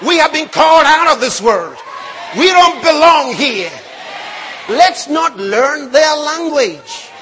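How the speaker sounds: loud, emphatic sermon exhortation; forceful preaching. Real, not acted. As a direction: drive it home to the congregation at full voice.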